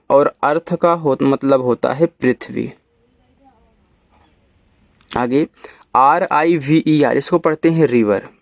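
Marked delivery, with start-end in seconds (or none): 5.9-7.2 slow, letter by letter; spelling out. Shown as a demonstration. Teacher spelling a word for beginners, slow spelling out. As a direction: spell it out slowly for learners.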